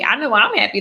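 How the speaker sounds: ordinary talk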